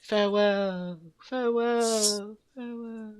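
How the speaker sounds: high, slow, fading theatrical farewell; dramatic farewell. Pretend.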